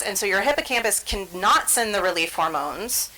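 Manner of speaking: ordinary talk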